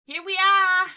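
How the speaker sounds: loud, high celebration; excited. Real, not acted. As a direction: cheer it out. Celebrating a finished build.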